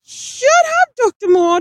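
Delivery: very high, loud yell. A narrator's reading. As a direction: scream at her to shut up. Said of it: "Dick yelled".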